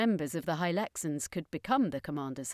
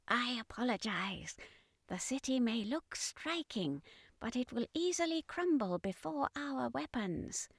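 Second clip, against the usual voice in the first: high, much softer alien voice; apologetic alien commander; 5 semitones higher, 8 dB quieter than usual. Acted. Commander apologizing to his deputy, soft high alien voice.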